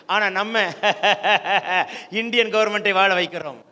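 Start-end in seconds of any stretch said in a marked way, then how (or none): 0.7-2.2 rhythmic mocking laugh; drawn-out ironic chuckle. Real, not acted. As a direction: laugh it out mid-sentence. Laughs midway through ironic point.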